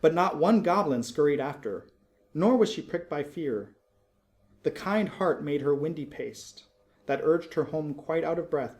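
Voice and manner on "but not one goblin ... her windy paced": plain reading voice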